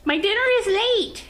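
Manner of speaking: high, whiny pet voice; dog voice. Pretend. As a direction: speak for the dog, high and put-upon. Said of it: "Mayhem is here to voice her opinions".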